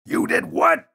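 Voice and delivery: loud shocked shout. A narrator's reading. Father hearing his grill is broken, loud shocked shout. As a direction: bellow it in disbelief. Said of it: "shouted Father".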